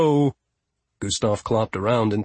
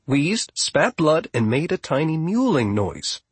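plain reading voice

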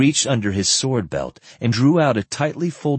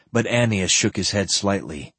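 ordinary delivery, nothing marked

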